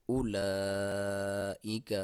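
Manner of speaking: اولئک chanted, the madd held long; slow recitation with madd. Shown as a demonstration. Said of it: "لام کے اوپر مد ہے".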